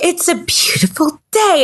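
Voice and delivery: high, breathy delighted whisper; animated sunny mom. Retold or imitated. Mom waking her moody kid, high breathy gush. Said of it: "exactly as animated as this".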